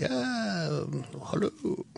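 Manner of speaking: put-on a breathy Russian girl says hello, lightly done; Russian accent. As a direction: Bond girl greets him in a mock Russian accent. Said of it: "Who knew [name] did a Russian accent".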